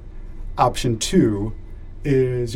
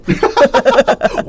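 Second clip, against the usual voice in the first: laughing; 7 dB louder, slower than usual. Real, not acted. Cracking up mid-sentence.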